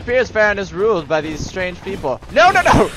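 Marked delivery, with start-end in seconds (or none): 2.3-2.9 high, loud cry of objection; urgent protest. Real, not acted. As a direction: shout to stop them at once. Shouting at fighting game enemies.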